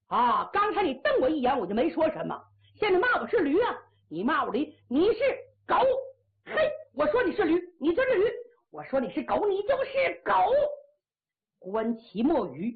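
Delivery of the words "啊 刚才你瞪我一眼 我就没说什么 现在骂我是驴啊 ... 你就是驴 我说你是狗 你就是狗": indignant insults, jumping high on the punches; quarrelling. Acted. Two chess-house quarrellers trading name-calling, heated and indignant. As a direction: trade heated, insistent insults.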